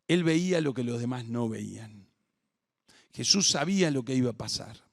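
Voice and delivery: normal talking voice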